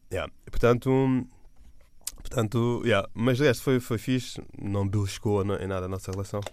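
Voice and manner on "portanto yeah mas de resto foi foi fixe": normal talking voice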